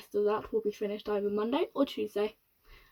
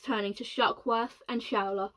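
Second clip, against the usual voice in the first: ordinary delivery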